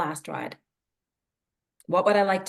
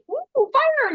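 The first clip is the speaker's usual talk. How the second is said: excited puppy; 4 dB louder than usual. Acted. Puppy voiced spotting hydrant.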